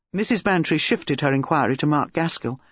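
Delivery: ordinary delivery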